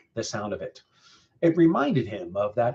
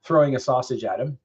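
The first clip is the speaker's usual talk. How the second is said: ordinary delivery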